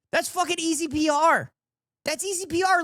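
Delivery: pitched high, scoffing at how easy it is; high, fast disbelieving rant; exasperated rant. Real, not acted. Streamer scoffing at the debate clip, high exasperated rant.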